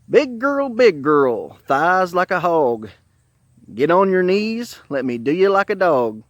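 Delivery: slow, emphatic chanted rhyme; crude poem recital. Retold or imitated. Coworker repeating the poem he told his wife, crude poem recital. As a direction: declaim each line boldly, drawn out.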